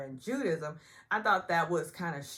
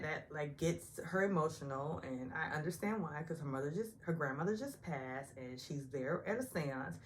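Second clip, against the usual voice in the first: ordinary delivery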